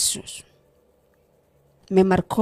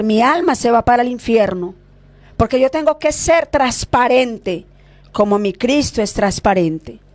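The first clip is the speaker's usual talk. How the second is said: ordinary talk, nothing marked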